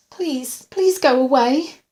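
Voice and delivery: high-pitched, desperate plea; beg, rising to loud on the second please; frightened pleading. A narrator's reading. Patient begging the intruder to leave, frightened pleading.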